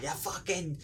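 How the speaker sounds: lightly voiced grumbling dad; disgruntled dad. Imagined suburban dad complaining, lightly acted grumble. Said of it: "probably, like, somebody's dad".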